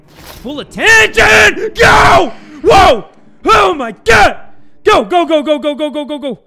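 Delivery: high loud frantic yelling, fading at the end; terrified shouting; panicked screaming. Real, not acted. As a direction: scream go go go while fleeing. Fleeing Hagrid in panic.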